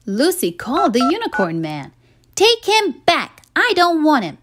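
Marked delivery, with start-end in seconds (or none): 2.3-4.4 high, fed-up demand; cross demand. Acted. Girl on the phone to the unicorn man, fed-up. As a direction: insist crossly.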